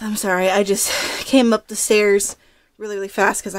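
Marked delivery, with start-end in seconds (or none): none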